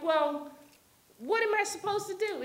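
put-on a teenager whining at her mother; whiny protest.

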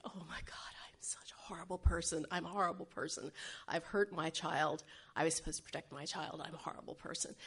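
hushed, half-whispered inner voice; guilty self-reproach. Retold or imitated. Mother who hurt her child, quietly berating herself. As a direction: murmur it softly to yourself, repeating the blame.

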